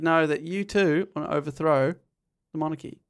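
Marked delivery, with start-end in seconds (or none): none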